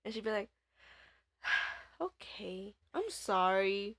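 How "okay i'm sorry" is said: big sigh, slow tipsy apology; sigh, then drag out the apology; drunk sister apologizing. Retold or imitated. Drunk sister told to hurry up, slow sighing apology.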